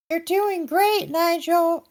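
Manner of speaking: very high, slow cheering voice; high chirpy voice. Acted. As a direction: cheer him on in a high squeaky voice. Gabby voiced by reader.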